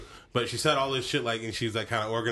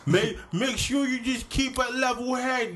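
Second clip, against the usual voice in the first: high, slow New York wiseguy voice; tough-guy New York accent; 11 semitones higher, slower than usual. Pretend. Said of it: "how'd you lose your New York accent".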